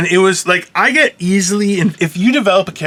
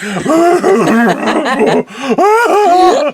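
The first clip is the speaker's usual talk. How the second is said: high, drawn-out fake wail; hammed-up ugly sobbing noises; mock ugly crying; 8 semitones higher than usual. Shown as a demonstration. Host demonstrating what an ugly crier they are, mock wailing. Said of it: "I would cry, and I'm an ugly crier".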